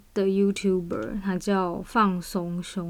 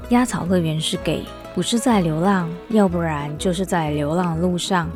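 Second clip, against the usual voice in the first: radio host intro. Acted. Podcast intro over music.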